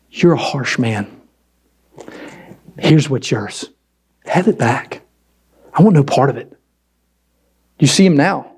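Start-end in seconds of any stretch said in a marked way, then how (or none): 0.1-6.6 accusing servant. Acted. Servant accusing his master and refusing any part, low accusation. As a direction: hand it back in cold, measured rejection. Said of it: "He comes with accusation".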